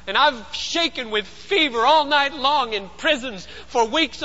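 high-pitched, incredulous complaint; aggrieved body arguing back; body's protest. Acted. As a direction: pile up the grievances, ending on a loud refusal. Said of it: "That's what the body says".

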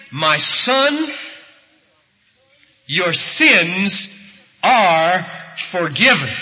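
slow, weighty proclamation; Jesus speaking. Retold or imitated. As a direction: declare it loudly, phrase by phrase. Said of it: "and he said".

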